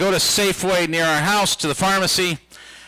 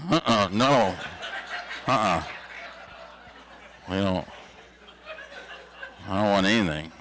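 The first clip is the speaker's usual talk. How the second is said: nervous refusal; 10 semitones lower, 7 dB quieter than usual. Retold or imitated. Kids answering mom at restaurant.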